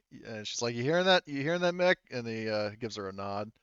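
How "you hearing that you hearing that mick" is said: raised, higher voice toward Mick; louder, higher-pitched aside to a pal; calling over to someone. Acted. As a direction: call out for a bystander to back you up. Character calls over to Mick.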